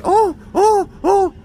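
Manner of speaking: very high, repeated mock-shocked ohs; imitated shocked ohs. Barista reacting to being sworn at, high mock-shocked ohs. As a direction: squeak out three separate little ohs in imitation. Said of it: "And he was like".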